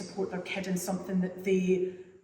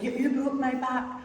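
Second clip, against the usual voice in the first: put-on child's complaint; 6 semitones higher, 5 dB louder than usual. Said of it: "She was like".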